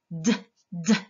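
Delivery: D as a short voiced tap, twice; isolated sound. Shown as a demonstration. Teacher isolating the first sound, short repeated bursts. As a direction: pop out a bare D sound two times. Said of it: "C'est le point dans le do".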